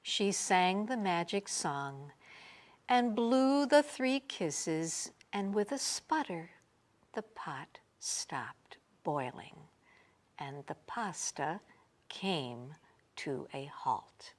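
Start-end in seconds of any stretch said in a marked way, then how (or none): 5.3-14.3 soft fading whisper. A narrator's reading. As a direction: let it settle into silence. Calm ending of the magic.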